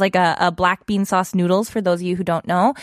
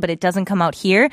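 usual speaking voice

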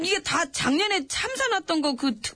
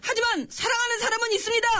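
high, formal recruit answer; military-style report; 6 semitones higher than usual. Acted. Underling answering the boss about love life, high formal report.

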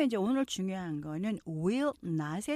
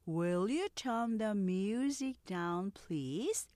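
slow, clear repeat-after-me English sentences; slow model reading; much slower than usual. Shown as a demonstration. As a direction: read each line slowly for students to copy. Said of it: "느린 속도 듣고 천천히 따라서". (따라 하기용 느린 속도 낭독)

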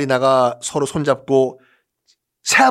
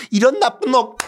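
할머니 호통 흉내; 9 semitones higher than usual. Retold or imitated. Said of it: "이런 식으로".

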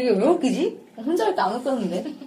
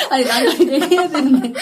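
giggling through the words; trying to talk while cracking up; laughing; 4 semitones higher, 7 dB louder than usual. Real, not acted. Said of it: "왜 자꾸 난간 얘기야".

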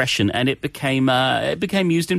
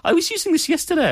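excited; faster than usual. Real, not acted. Excited aside about using it yesterday.

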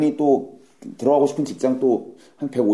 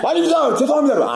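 very high, operatic recruit cry; loud recruit apology; 12 semitones higher than usual. Acted. Clueless recruit answering a whispered scolding, high blaring apology. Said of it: "또 크게 대답을 해요".

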